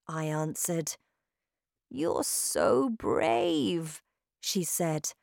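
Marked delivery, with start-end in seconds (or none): 1.9-4.0 tender praise. A narrator's reading. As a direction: say it slowly and lovingly. Grandmother praises child.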